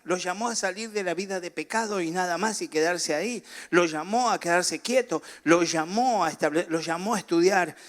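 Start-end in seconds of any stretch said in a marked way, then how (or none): none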